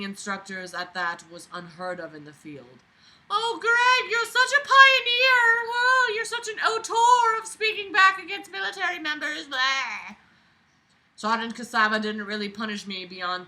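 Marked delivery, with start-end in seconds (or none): 3.3-10.2 high, loud sarcastic fawning; mocking gush. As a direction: gush in fake admiration to mock him. Sarcastic gushing mock of the author.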